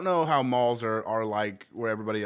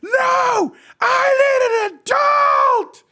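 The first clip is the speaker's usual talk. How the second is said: put-on shrieking high and loud like a scared child, drawn out; high crying scream; 16 dB louder, much slower than usual. Husband retells shrieking at the mall kiosk lady, high crying scream. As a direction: wail it like a frightened kid. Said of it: "He literally was screaming".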